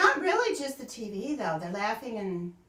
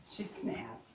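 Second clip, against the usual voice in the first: hushed, low, breathy mock-drama; dramatic whisper; 14 dB quieter than usual. Mocking her friend's dramatic reading, in a whisper. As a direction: whisper it like a gossip. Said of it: "Or was that just your dramatic reading?".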